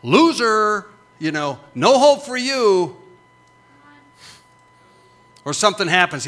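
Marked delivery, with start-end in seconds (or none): 0.0-3.0 loud, higher mock jeer; the world jeering at a failure; scornful world. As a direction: sneer it out like a contemptuous crowd. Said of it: "the world is just broadcast".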